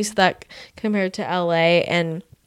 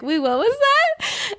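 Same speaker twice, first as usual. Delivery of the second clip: high, slow, puzzled "wait, what was that?"; mock bewildered, straining to understand a thick accent; confused asking again; slower than usual. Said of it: "I'm like".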